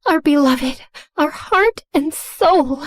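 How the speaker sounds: weepy, high-pitched lament. Acted. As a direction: mourn a beloved idol, drawn out. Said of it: "forming a weepy group hug".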